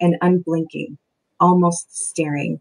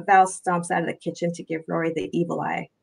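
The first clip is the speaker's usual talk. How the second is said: ordinary delivery, nothing marked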